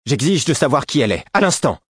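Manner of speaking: high, imperious outburst; exasperated demand. A narrator's reading. Betrayed prince demanding to know who she is, exasperated. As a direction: demand it at once, voice leaping up at the end. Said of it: "une violente exaspération".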